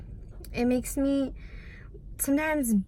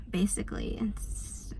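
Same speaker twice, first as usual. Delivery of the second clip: whispering; 2 semitones lower, 10 dB quieter than usual. Real, not acted. Tearful end of vlog.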